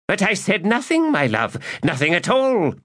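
put-on high, wheedling old man; innocent protest. Magus protesting to the priestess, high and wheedling. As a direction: plead innocence far too sweetly.

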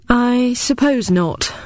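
high, slow, drawn-out reluctant agreement; noncommittal. A narrator's reading. Replying to the veteran while staring out of the window, noncommittal. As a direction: draw it out slowly, committing to nothing. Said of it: "I replied noncommittally".

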